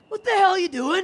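high-pitched mock-angry demand; upset dad. Dad catching her at the cupboard, mock upset. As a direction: imitate a father's outraged challenge. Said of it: "He got very upset with me".

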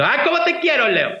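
high, excited cry of love for Leo; shouted gush. Retold or imitated. Host shouting her love to Leo fans, high pitched. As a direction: call it out up high, gushing. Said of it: "siempre grito".

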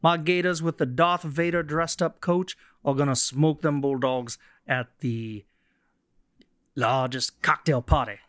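light put-on New Orleans accent as a radio caller. Caller phoning the host in a mild New Orleans accent. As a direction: call in as a Gator fan from New Orleans. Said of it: "which is a New Orleans accent".

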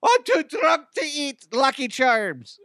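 squealing stage-Irish voice; Irish guy. Shown as a demonstration. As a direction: ham up a drunken Irish accent. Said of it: "an Irish guy".

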